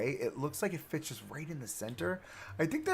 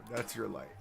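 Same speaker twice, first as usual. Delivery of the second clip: ordinary talk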